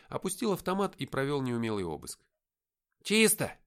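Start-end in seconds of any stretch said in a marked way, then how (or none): 3.0-3.5 short, high, loud report; громкий доклад. A narrator's reading. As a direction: call out the all-clear like a soldier. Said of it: "заявил он". (громкий солдатский доклад)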